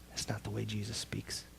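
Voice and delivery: breathy whisper. Real, not acted. Preacher to listeners, hushed whisper. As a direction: drop to a secret whisper. Sudden aside after the skeptic.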